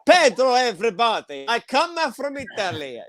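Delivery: hammed-up fake Italian accent. Host mimicking the comic in a bogus Italian accent. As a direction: introduce yourself in a mock Italian lilt. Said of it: "Why you make an Italian accent".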